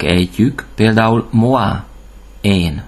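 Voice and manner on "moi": moi with oi said as "wa"; French moi, gliding from O into A. Shown as a demonstration. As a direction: say moi like "mwa". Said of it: "O I betűcsoportot mindig O A-nak".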